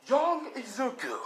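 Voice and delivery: slow, high heroic call; All Might calling out. Acted. All Might stopping Izuku with a slow high call. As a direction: call him gently to stop, slowly.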